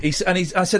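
usual speaking voice

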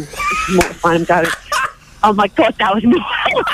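ordinary delivery

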